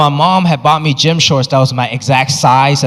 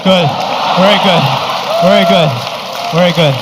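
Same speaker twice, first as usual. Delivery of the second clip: approving dad. Retold or imitated. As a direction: praise him like a proud strict father. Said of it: "he was like".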